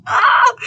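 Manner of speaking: high, loud mock wail of mortification; embarrassed shriek. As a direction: cry out in comic embarrassment. Wailing over her own embarrassment.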